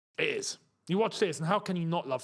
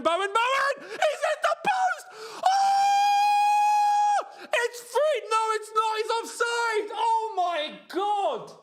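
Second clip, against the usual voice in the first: high, loud shouts of disbelief; excited shouting; 5 dB louder, much slower than usual. Real, not acted. Fan watching the match screams at the post and the offside call, excited shouting. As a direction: yell it as the shot hits the post. Near goal then offside.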